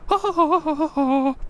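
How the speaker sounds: high cackling laugh; high, mocking cackle; mocking knight laugh. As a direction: laugh at the fool.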